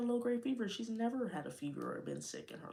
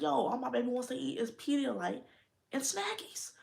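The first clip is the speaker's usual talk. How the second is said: exasperated retelling; 7 semitones higher, 5 dB louder than usual. Retold or imitated. Retelling her own worried outburst.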